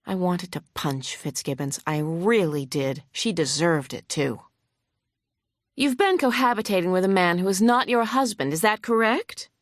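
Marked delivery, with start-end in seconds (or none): 5.8-9.5 a hint of a huffy, pointed questioner, somewhat higher; lightly voiced hostile lawyer. Opposing lawyer grilling the vet on the stand, mildly huffy. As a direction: press the witness with mild scorn.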